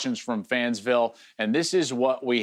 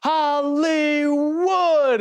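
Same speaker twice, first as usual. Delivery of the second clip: much higher, louder, slowly stretched shout of the name; big play call; 12 semitones higher, 5 dB louder, much slower than usual. Retold or imitated. Analyst imitating Gus's first big Hollywood call, loud and drawn out. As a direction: belt the nickname like a huge play just happened. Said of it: "he goes".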